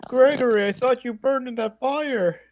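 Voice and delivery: high, slow spooky voice; character voice. Acted. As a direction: speak as a haunting figure. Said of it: "do the whole voice thing of him saying".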